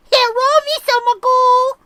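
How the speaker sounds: put-on very high, loud caricature accent greeting; old Charlie voice. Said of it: "Charlie would sound like Bry's Ming voice".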